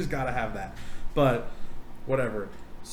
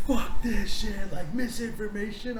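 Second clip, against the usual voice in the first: higher, slow mock outrage; higher, slow imitation of offended Discord users; mock angry Discord users; 7 semitones higher than usual. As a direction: ham up their upset reply. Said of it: "And they were like".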